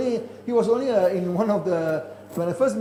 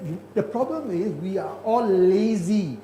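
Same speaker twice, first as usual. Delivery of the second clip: ordinary delivery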